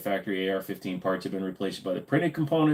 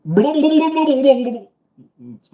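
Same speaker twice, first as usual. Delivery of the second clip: excited; 9 dB louder than usual. Real, not acted. On the e-board.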